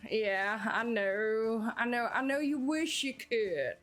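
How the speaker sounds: ordinary talk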